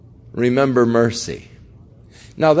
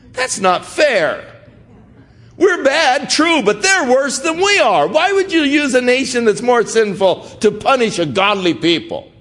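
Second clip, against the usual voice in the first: indignant protest; 10 semitones higher than usual. Retold or imitated. Habakkuk protests God's plan.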